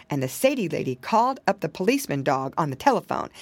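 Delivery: plain reading voice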